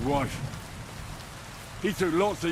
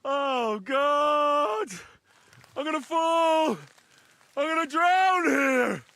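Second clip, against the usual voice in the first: screaming while falling; 7 semitones higher than usual. Acted. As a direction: panic as he slips and falls.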